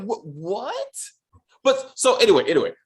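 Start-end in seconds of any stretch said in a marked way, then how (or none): 0.0-0.9 soft, drawn-out baffled "what", rising. Retold or imitated. Reviewer recalling confusion at the plot twist, baffled. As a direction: let the question climb in quiet disbelief.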